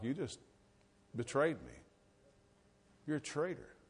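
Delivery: soft, halting reproach; quiet accusation. Acted. Someone looking at the one who betrayed them, quietly accusing. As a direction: let the hurt accusation fall softly, with pauses. Voicing a silent, accusing look.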